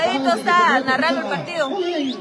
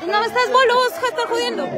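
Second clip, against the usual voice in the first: laughing tease; 7 semitones higher than usual. Real, not acted. Teasing a drunk friend.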